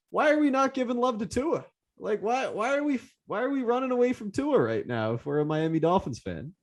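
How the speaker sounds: pleading in disbelief; incredulous. Real, not acted. Disbelief about dropping Tua.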